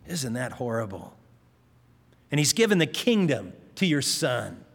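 ordinary talk, nothing marked

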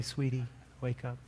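soft, tender murmur; gentle, quiet parent's voice; gentle wake-up. Acted. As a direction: coax a sleeping child awake very softly. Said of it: "I don't wake them up so gently".

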